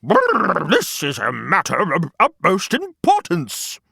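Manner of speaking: a grand turkey offering his path; pompous turkey. Acted. Turkey Lurkey, pompous reply.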